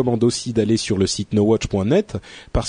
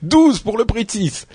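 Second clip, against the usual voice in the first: infomercial; 9 semitones higher than usual. Pretend. Continues mock shopping ad.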